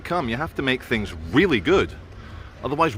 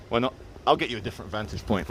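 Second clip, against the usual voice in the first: ordinary talk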